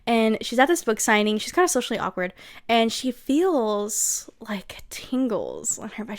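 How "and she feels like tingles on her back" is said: whisper. Acted. Narrating a creepy feeling.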